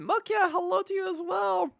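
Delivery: high squeaky hello; high greeting voice. Acted. Greeting a chatter.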